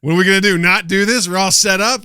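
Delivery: loud, high, fired-up; fired-up rant. Retold or imitated. Telling friends why the show went on, loud fired-up rant. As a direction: push it out like a pep talk to yourself. Defiant rallying retold.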